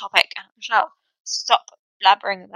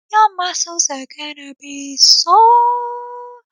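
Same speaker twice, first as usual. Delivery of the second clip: mock high warning; 9 semitones higher, slower than usual. Warning beginners.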